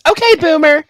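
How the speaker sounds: high, loud dismissive quip; Valley Girl okay boomer. Retold or imitated. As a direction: sneer it like a teen girl. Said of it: "do it with that one chick's".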